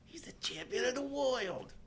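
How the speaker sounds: high whispered mock Mickey; imitating Mickey. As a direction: rasp it like old Mickey pleading. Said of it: "as Mickey can".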